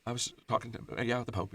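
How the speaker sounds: soft, hesitant mock mumble; mumbling to himself. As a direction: trail along quietly as if talking to yourself. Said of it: "He's just kinda mumbling to himself".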